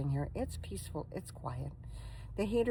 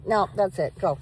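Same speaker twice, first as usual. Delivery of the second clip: loud, higher "No, that's it" at the dog; firm scold; 3 semitones higher, 8 dB louder than usual. Real, not acted. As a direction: shut the dog down firmly.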